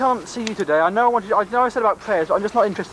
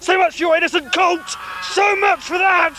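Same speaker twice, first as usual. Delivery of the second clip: panicked; 10 semitones higher than usual. Acted. Victim panics, voice climbs.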